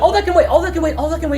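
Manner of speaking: high, loud deflection; NPC brushing off. Acted. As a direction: cut their questions off.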